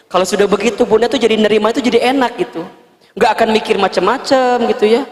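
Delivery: ordinary delivery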